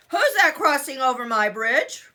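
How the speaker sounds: booming troll challenge; deep scary troll roar. Acted. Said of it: "roared the mean old troll in his deep, scary voice".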